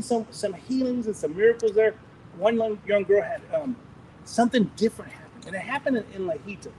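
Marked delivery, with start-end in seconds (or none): none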